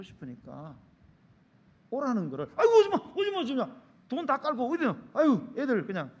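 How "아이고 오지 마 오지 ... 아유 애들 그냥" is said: high, loud insistent refusal; fussy parental protest. Acted. Lonely parent telling the child not to come, fussy loud protest. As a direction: insist loudly that nobody come.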